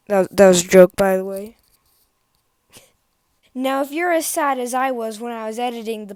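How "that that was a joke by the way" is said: sudden booming aside; loud blurt. Real, not acted. Vlogger quickly tells viewers it was a joke, booming. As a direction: blast the disclaimer out.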